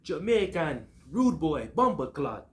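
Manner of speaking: put-on playful Jamaican accent shout-out. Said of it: "I got my Jamaican accent with me".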